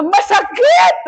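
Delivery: high-pitched mock scream; retold pain shout. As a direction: cry out as if cut, without full volume. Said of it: "sumigaw po ako na".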